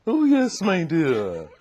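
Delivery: high, loud, plummy grande-dame voice; Dorothy Height. Retold or imitated. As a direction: swoop down from high, plums in the mouth. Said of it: "All the plums in her voice".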